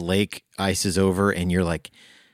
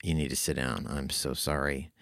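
hushed and grave, much softer; quiet, somber; 4 semitones lower, 9 dB quieter than usual. Retold or imitated. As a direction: break bad news softly. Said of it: "she's like".

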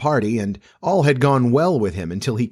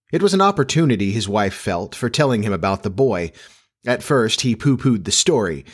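plain reading voice

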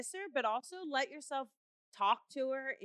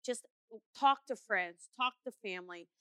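ordinary talk

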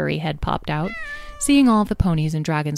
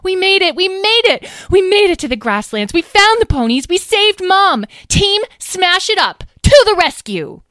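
much higher, louder whoops of joy; overjoyed; 8 dB louder than usual. Acted. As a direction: cheer it out, over the moon. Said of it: "was overcome with joy".

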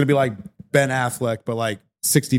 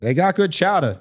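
put-on Boston accent; 7 semitones higher, 3 dB louder than usual. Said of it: "doing the fucking Boston shtick".

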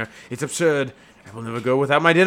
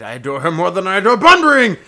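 excited; 7 semitones higher, 9 dB louder than usual. Acted. Reading comic play scene, excited.

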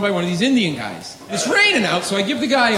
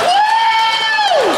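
piercing high loud woo; wild woo; 5 dB louder than usual. Retold or imitated. Comic whooping at the vendor, wild high whoop. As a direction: let out a crazed whoop.

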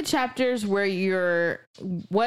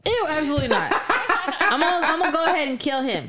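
laughing; 7 semitones higher, much faster than usual. Real, not acted. Laughing rejection of character.

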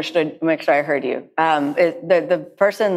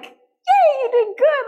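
mock cheer; slower than usual. Wishing the teacher would squeal high praise. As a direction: cheer like someone handing a kid a trophy.

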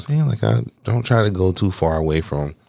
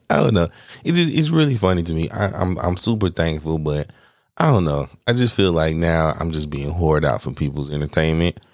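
ordinary talk

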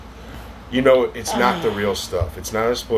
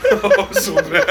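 high, slow laughing groan; much slower than usual. Real, not acted. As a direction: laugh out how gross it is. Tasting a gross bean.

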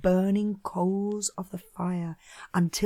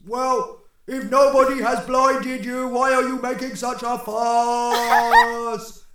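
higher, louder put-on giant voice; puzzled other Cyclops; 3 semitones higher, 4 dB louder than usual. Second Cyclops replying to the blinded one's fuss, puzzled. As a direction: voice a baffled giant neighbour.

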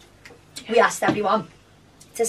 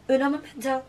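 playful fake Spanish, higher and laughing; mock Spanish twang; 5 semitones higher than usual. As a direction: say it like a tourist trying Spanish. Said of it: "Spanish twang".

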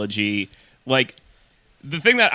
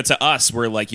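ordinary talk, nothing marked

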